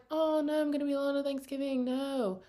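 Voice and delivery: usual speaking voice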